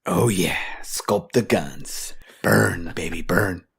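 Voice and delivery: slow, deep trainer growl; low gym coach voice. Acted. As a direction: hype up the workout, low and slow.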